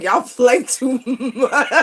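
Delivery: slow, laughing disbelief; drawn out through laughter; laughing exasperation. Real, not acted. Laughing at the heavens over a nineteen-year-old suitor.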